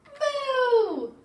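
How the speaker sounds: high, loud, drawn-out moo; long playful storybook moo; baby's moo. Acted. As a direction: moo out like a delighted baby. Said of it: "Baby says".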